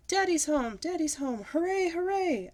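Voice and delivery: high, loud happy cheer; yelled happily. A narrator's reading. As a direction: cheer for daddy coming home. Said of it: "I yelled".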